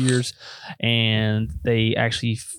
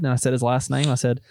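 ordinary delivery

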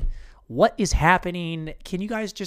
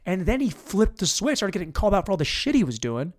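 ordinary talk

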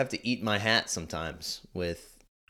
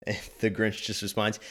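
ordinary delivery

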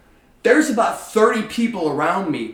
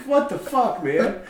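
exasperated disbelief; 5 semitones higher, slower than usual. Retold or imitated. Exasperated reaction.

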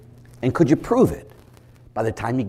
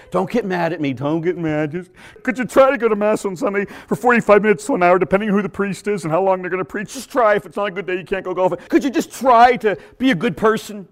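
higher, timid begging tone; mock pleading priest; 7 semitones higher than usual. Weak priest pleading with parishioners, mock whiny voice. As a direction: beg the parish meekly.

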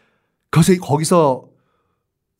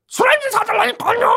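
a wrecked voice after swallowing charcoal; ruined voice. Shown as a demonstration. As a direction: sound like charcoal burned your throat. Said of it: "이런 목소리를 한다".

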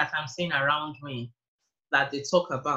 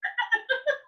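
high laugh. Real, not acted. Guest laughing at remark.

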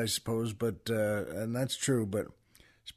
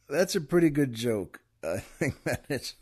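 laughing; 5 semitones lower, 6 dB quieter than usual. Real, not acted.

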